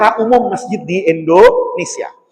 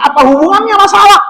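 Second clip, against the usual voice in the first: exasperated; 11 semitones higher than usual. Retold or imitated. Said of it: "kata saya".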